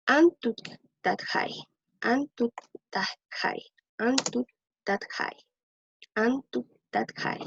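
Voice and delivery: Antuktadkhai said slowly and clearly, again and again; careful teacher repetition of Antuktadkhai; slow repeated model word. Shown as a demonstration. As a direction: drill Antuktadkhai slowly for the class. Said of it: "para futuro".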